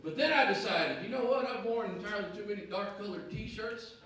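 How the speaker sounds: ordinary talk, nothing marked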